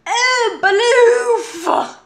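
high, loud cartoon oof yelp; mock Roblox oof cry; Roblox noise. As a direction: blurt a video-game death grunt. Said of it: "The Roblox noise".

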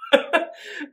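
airy laugh; breathy giggle. Real, not acted. Laughing again.

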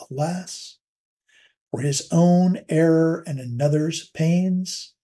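slow, measured poetry reading with long pauses between lines; poem recitation. Shown as a demonstration. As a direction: read each line slowly, letting the metre show. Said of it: "I tried to also show one of the other, um, key components of the sonnet in reading that".

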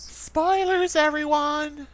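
very high drawn-out falsetto; squeaky put-on announcer; high mock warning. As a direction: call out in a silly falsetto announcement. Mock spoiler warning to viewers.

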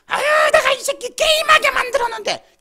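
high, loud strained screech; squealing demon shout; cursing demon. Acted. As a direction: shriek it as a gloating demon. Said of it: "그 속에서 귀신이 욕짓거리를 하는 거야".

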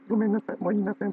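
put-on child apologizing. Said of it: "とか言って".

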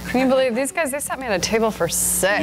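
rattled off quickly; fast and playful. Acted. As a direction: toss it off fast, breaking good girl. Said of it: "Atta girl, that's two".

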